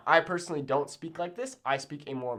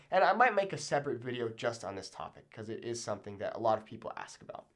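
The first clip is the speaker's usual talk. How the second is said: normal talking voice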